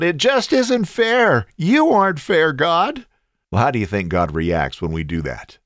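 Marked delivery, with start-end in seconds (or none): 0.0-3.1 much higher, louder and slow, like a wounded protest; angry outcry at God. Pretend. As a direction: cry out that it isn't fair. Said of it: "gotten angry at God and cried out".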